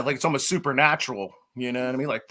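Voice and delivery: usual speaking voice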